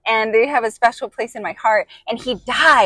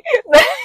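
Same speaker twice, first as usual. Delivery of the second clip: high giggle burst; 14 semitones higher, 3 dB louder than usual. Real, not acted. Laughing at crying story.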